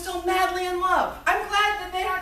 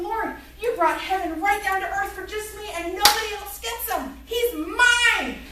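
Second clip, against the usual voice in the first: high, gushing thanks with a louder, possessive finish; smitten gushing; 6 semitones higher, 4 dB louder than usual. Retold or imitated. Young bride thanking God for her man, smitten gushing. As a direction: gush it, building to a triumphant last word. Gushing prayer about new husband.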